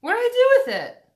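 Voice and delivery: high, loud frustrated outburst; exasperated loud cry. Real, not acted. Vlogger can't find her lip gloss, loud frustrated cry. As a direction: blurt it out, fed up.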